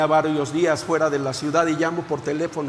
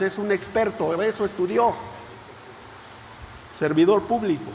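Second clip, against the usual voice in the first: normal talking voice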